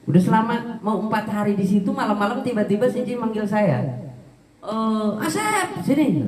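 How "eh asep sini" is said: boss calling out. Retold or imitated. Boss calling him at night.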